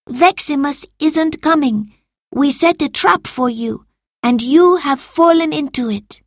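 high-pitched accusing tone; stern confrontation. A narrator's reading. Marcus revealing the trap to Salvius, stern. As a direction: confront a trapped culprit. Master confronts trapped servant.

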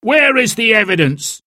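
high, indignant call; heckling challenge. A narrator's reading. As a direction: demand proof from the crowd. Heckler challenges speaker at meeting.

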